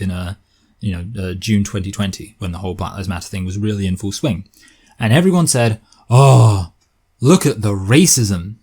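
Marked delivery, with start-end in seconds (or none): 6.1-8.6 drawn-out, exaggerated gasp of dismay; mock outrage. Everyone reacting to the barrister story, mock outrage. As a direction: play up the shocked reaction, slowly.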